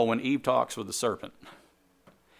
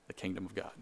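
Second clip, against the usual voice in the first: usual speaking voice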